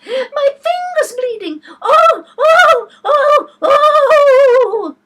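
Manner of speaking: very high, very loud screams; screaming. Acted. Queen wailing about her bleeding finger, screaming. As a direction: scream like a steam whistle. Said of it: "Her screams were so exactly like the whistle of a steam engine".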